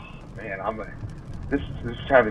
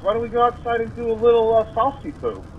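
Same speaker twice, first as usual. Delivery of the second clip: playful party invite; 8 semitones higher than usual. Retold or imitated. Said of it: "I'm like".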